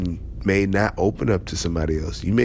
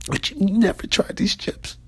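much higher, hammed-up squeal; squeaky disbelief; 13 semitones higher than usual. Chip fan squealing at a coworker's news. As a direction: squeal in mock shock. Acting excited friend about chips.

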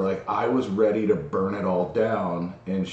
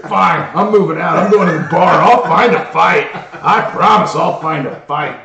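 loud, higher, mock-furious threats; hammed-up tantrum; 7 semitones higher, 10 dB louder than usual. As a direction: storm off in a theatrical huff.

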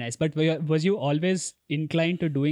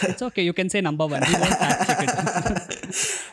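laughing; 5 semitones higher, 7 dB louder, slower than usual. Real, not acted. Host teasing guest about ranking.

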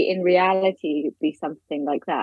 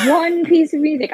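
laughing disbelief; 9 semitones higher, 4 dB louder than usual. Real, not acted.

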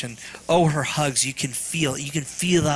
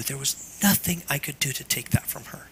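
breathless grieving whisper; real whispered grief, voice giving out; 6 dB quieter than usual. Real, not acted. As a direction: barely whisper it, holding back grief. Grieving father over lost daughter.

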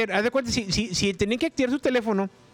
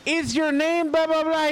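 mock slow agent; 8 semitones higher, much slower than usual. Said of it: "agent asking the caller".

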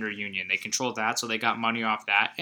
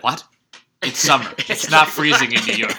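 mock insistent, louder; 9 dB louder than usual. Quoting De Niro.

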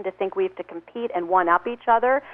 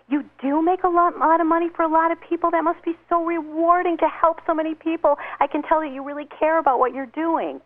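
higher, sincere admiring praise; admiring compliment; 7 semitones higher than usual. Retold or imitated. The speaker praising a bragging guy, lightly gushing admiration. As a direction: pour on the praise so he feels important. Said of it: "So I said to him".

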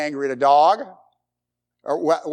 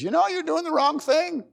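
a hint of the Holy Spirit, pitched higher; Holy Spirit's voice; 10 semitones higher than usual. Pastor voicing the Holy Spirit to the congregation, lightly pitched higher. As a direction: gently voice the inner conscience. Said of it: "That Holy Spirit says".

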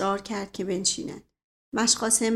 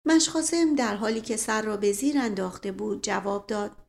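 usual speaking voice

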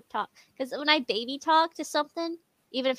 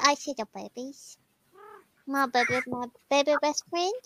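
put-on baby talk voice. Shown as a demonstration. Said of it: "she associates that voice".

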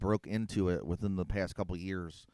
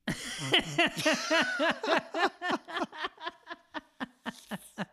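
long, high, helpless laugh; high-pitched laugh. Real, not acted. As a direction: laugh until out of breath. Big laugh at joke.

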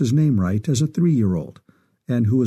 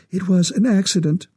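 higher-pitched, upset woman with a slight tremble; trembling voice; 7 semitones higher than usual. A narrator's reading. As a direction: say it as a distressed mother, pitched up. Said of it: "Her voice trembled".

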